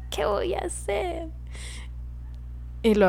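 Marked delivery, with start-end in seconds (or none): 0.1-1.3 high, soft helpless question; mock helpless whine. Quoting her younger self to Leonor, high and helpless. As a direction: sound lost and pitiful. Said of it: "cuando yo decía".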